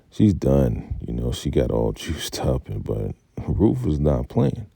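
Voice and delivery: ordinary delivery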